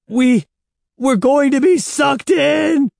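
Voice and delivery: high, loud panicked scream; full-voiced frightened yell; terrified scream. A narrator's reading. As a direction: scream it out in terror. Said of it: "Evan screamed".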